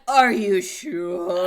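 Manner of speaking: slow, loud, drawn-out doubting question; doubtful. Retold or imitated. Friends at the restaurant grilling her over the fries, slow and loud. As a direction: stretch each word as if you can't believe it. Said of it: "They just kept being like".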